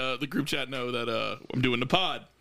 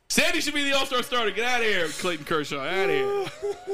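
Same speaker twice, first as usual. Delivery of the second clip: Stephen A. ranting; 10 semitones higher than usual. Pretend. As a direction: wind up into a hammed-up TV rant. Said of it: "Stephen A. getting mad".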